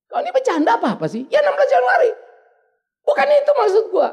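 teriakan jengkel yang tinggi dan keras; makin marah. Retold or imitated. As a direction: menirukan pertengkaran suami-istri yang memanas. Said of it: "Makin marah lagi saya". (makin marah, suara meninggi)

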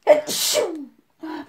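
loud sudden sneeze; a real sneeze bursting out. Real, not acted. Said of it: "je vais éternuer".

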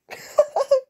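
loud high giggle; high laugh. Real, not acted. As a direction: burst out giggling. Laughs at own joke.

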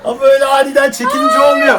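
loud complaint. Real, not acted. Complaining after a fall.

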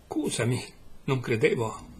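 usual speaking voice

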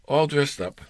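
usual speaking voice

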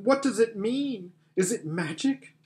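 high, bewildered questioning; distressed cry. Acted. Susan bewildered by the broken table, distressed cry. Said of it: "cried Susan".

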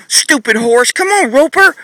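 high exasperated whine; mock-frustrated owner giving up; frustrated nagging. Shown as a demonstration. Trainer acting a fed-up owner for viewers, high whine. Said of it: "I'm gonna show you what nagging and what most people do".

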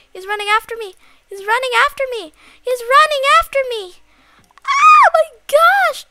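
mounting panic. Pretend. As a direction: build from alarm to shrieking. Chased by monster, rising panic.

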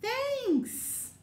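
high, loud bright thanks; bear's thanks. Acted. Bear thanks Hare for the muffins, bright and high. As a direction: answer for the kids as Bear. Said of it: "Your turn".

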